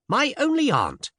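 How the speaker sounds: put-on high-pitched surprised young lady; astonished exclamation. A narrator's reading. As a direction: exclaim in high, startled amazement. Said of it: "she exclaimed".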